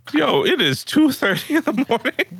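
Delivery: high-pitched mock disbelief; playful exasperated outcry; incredulous objection. Acted reply to stroll suggestion.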